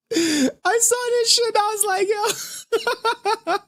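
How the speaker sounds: high-pitched words squeezed out through real laughter; laughing so hard the words crack up high. Real, not acted. To viewers, recalling the post, laughing helplessly. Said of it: "we couldn't contain our laughter".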